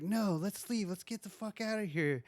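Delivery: high, urgent mock plea at normal volume; alarmed pleading. Girl urging the guys to leave, alarmed pleading. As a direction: imitate a scared girlfriend begging to go.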